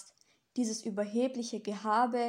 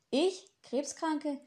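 startled; 5 semitones higher, much slower than usual. Acted. Angel startled by accusation.

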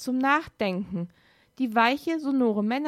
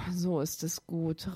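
soft luring murmur; low murmur; 4 semitones lower, 6 dB quieter than usual. A narrator's reading. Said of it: "raunte die Stimme". (leise raunend)

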